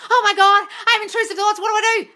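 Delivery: high, loud mock panic; hammed-up panicking follower, mocked by the host; mocking panicker. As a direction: whine it like a fretful follower. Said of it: "just let your mind run away".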